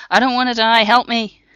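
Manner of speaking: loud, high panicked begging; desperate plea. Acted. Dying Block begging Freya for help, panicked and loud. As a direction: plead for life in terror.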